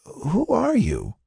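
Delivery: slow, very high question; weak, puzzled patient. Acted. Patient questions unknown visitor, slow high puzzled voice. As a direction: draw it out feebly.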